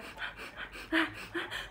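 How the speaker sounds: breathy high wheeze of a laugh-cry; mock weeping laugh. Laughing at herself while retelling the sob to her aunt. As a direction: laugh at her own ugly crying. Imitating her own ugly crying.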